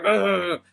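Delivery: loud, high mock battle yell; war cry. Johnny tackling a surfer on the beach, yelling. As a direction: charge in with a roaring yell.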